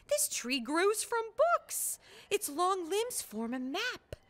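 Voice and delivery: lightly voiced awed bear cub; amazed little bear. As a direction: marvel at the tree. Character voice of Blue.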